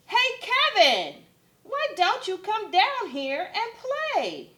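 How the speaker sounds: loud high call up the tree; shouted call. Acted. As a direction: shout up to him to come play.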